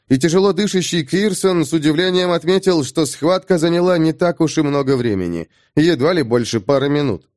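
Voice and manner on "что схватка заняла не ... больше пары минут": usual speaking voice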